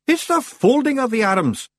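high, loud, sharp correction; snapping Rob Anybody. A narrator's reading. Rob correcting Daft Willie, snappish. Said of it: "snapped Rob Anybody".

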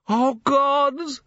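high, anguished cry; man watches gnome jump, anguished; despairing cry. A narrator's reading. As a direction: cry out in dread. Falling peril repeated exclamation.

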